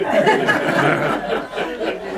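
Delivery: high, unrestrained laughter at his own punchline; real delighted laugh; big real laugh. Real, not acted. Laughs after coal miner joke.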